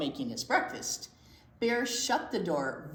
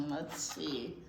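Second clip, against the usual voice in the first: usual speaking voice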